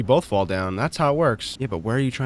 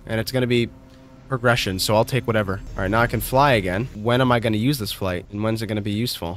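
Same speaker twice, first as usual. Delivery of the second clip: ordinary talk, nothing marked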